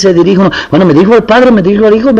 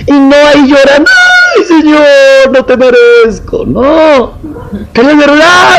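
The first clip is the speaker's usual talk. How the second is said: higher, impassioned preacher voice; fervent preaching; 9 semitones higher than usual. Acted. Pastor exhorts his congregation, fervent preaching. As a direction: preach it out with fervour.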